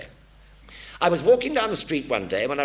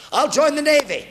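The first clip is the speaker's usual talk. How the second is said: much higher and louder; triumphant decision; 9 dB louder than usual. Retold or imitated. Young man picking his service, mock triumphant. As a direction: announce it like a grand solution.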